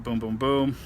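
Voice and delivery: ordinary delivery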